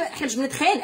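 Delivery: usual speaking voice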